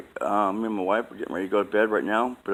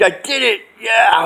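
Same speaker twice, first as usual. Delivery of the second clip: higher, louder exultant shout; self-congratulating cheer; 13 semitones higher, 7 dB louder, slower than usual. Retold or imitated. Man retelling his own victory to viewers, exultant shout. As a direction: crow about your win.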